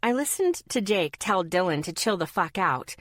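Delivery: ordinary delivery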